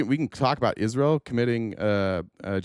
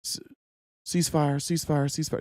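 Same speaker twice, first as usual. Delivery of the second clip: higher mock Trump, dismissive sing-song; a hint of a mocking Trump voice, higher; 4 semitones higher than usual. Mocking Trump's ceasefire promises, lightly voiced higher parody. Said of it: "he talked about it all the way through February".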